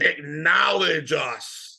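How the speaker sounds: slow, loud, drawn-out demand; wrestler catchphrase. Acted.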